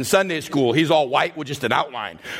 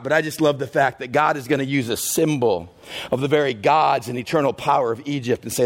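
normal talking voice

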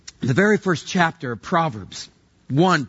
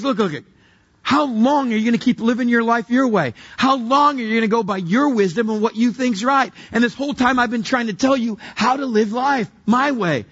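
higher-pitched, driving preacher's scolding; God's exasperated rebuke; 7 semitones higher than usual. Acted. God warning people who refuse to listen, exasperated rebuke. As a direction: build a list of warnings in a raised pitch.

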